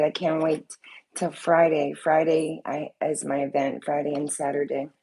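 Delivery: ordinary talk, nothing marked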